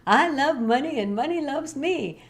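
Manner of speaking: high, lilting sing-song affirmation; hammed-up affirmation. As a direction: chirp it like a too-easy mantra. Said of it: "someone gives you an affirmation".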